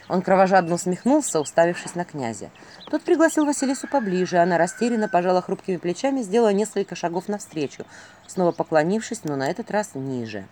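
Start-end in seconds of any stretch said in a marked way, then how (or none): none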